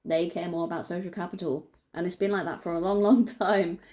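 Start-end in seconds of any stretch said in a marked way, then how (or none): 2.2-3.8 real small laugh breaking into the words. Real, not acted. Speaker to viewers about a long-standing problem, laughing in disbelief. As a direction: laugh briefly through the phrase. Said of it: "And I'm laughing".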